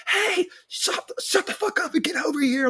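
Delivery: shrill high yell across the crowd; retold screaming. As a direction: scream for help over the music. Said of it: "I'm screaming my head off".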